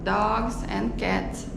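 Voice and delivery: ordinary talk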